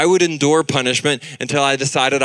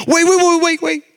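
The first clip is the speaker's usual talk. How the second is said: very high, loud frantic pleading; panicked little girl; 5 dB louder than usual. Retold or imitated. Daughter held overhead, begging dad in a panic. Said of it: "And she goes".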